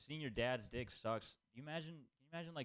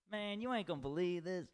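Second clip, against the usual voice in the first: a hint of a put-on street accent, higher; lightly slangy accent as a hotline worker; mild put-on accent; 7 semitones higher, 4 dB louder than usual. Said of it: "because I kept doing that accent".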